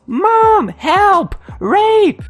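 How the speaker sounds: very high, loud screams for help; desperate shrieked cries; screaming. Acted. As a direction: scream each word at the top of your lungs. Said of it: "screaming at the top of my lungs".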